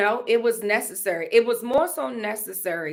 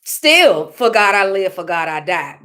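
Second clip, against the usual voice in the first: fervent declaration; 2 semitones lower, 9 dB louder than usual. Retold or imitated. Retelling her crying prayer to God, loud and fervent. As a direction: proclaim it with full conviction. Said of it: "cry and talk to God and say".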